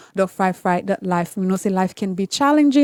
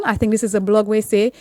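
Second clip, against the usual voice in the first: ordinary delivery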